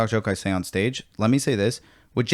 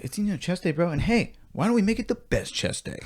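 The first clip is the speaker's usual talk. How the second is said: gym bro; 8 semitones higher, 5 dB quieter than usual. Retold or imitated. Mocking gym trainer talk.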